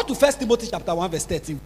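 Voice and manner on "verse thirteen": ordinary talk, nothing marked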